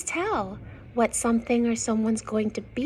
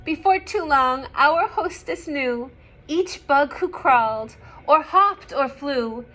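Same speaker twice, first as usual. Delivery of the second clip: clear, louder storybook reading; story narration; 8 dB louder than usual. Acted. Reading a picture book to young children, lively narration. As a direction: read the rhyme out to the children.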